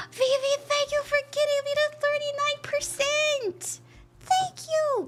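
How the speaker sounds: high-pitched, gushing gratitude; gasp, then thank them way up high; excited thanks. Real, not acted. Thanks a donor after gasping.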